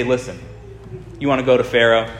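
usual speaking voice

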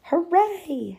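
high cheering hooray. Acted. Willa cheering with the tape measure, excited. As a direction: celebrate with a cheer.

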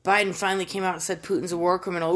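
ordinary talk, nothing marked

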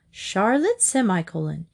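usual speaking voice

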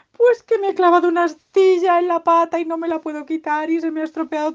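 high, loud whining tirade; hammed-up tearful creature complaint; sad piglet. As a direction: pile up the woes in mock despair. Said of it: "pero no te pongas triste".

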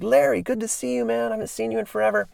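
high, quick, gushing hello; excited reunion. Retold or imitated. [name] greeting old skater Larry, high and quick. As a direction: gush like you spotted an old friend. Retelling own excited reply.

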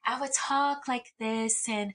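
polite, careful phone voice; customer service voice. Shown as a demonstration. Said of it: "sound very in my customer service voice".

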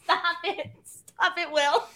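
high, soft, teary pleading; tearful laugh. Real, not acted. To Will, half laughing through tears. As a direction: brush it off while tearing up. Embarrassed, tearing up.

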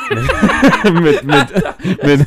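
vor Lachen stockend; laughing. Real, not acted. Lacht über den erfolgreichen Freund. (lachend stockend)

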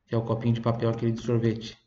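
ordinary talk, nothing marked

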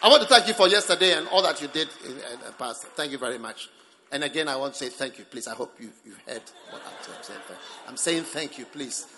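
rushed thanks, loud start dropping low; insincere thanks. Acted. Grudging person putting thanks on record, rapid and ungrateful. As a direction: reel off perfunctory thanks as a formality. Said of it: "doesn't carry the message of thanksgiving".

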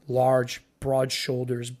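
ordinary delivery

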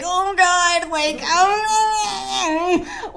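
high, drawn-out mock moan; long wailing "oh"; overwhelmed moan. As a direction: wail it out, hammed-up. Reenacts being overwhelmed in bed.

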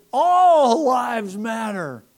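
loud, high, very slow shout; drawn-out shouted slogan; yelled. Retold or imitated. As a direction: hurl it out the window. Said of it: "And then I said".

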